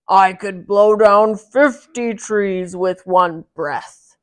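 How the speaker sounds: loud, slow booming boast; giant voice. Acted. Giant bragging to Mickey, slow and loud.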